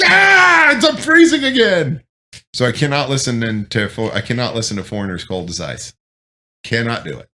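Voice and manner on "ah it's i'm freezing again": high, loud mock scream of freezing; mock yell. Him reacting to the song on the radio, a high, loud mock yell. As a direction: yell as if freezing on post again. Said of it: "I'm just like".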